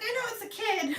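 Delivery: very high mock cursing; hammed-up high-pitched exasperation; exasperated mom. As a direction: imitate a mom squealing in frustration. Retold mom blames the kid.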